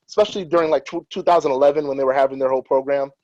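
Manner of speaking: ordinary talk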